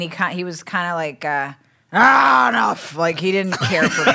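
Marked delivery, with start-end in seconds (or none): 1.9-3.0 loud, high mock groan of annoyance; imitated scolding. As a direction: act out an irritated man brushing her off. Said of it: "He scolded me".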